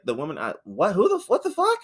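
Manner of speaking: hammed-up bewildered Mary Jane; mock confusion. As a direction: play a baffled girlfriend.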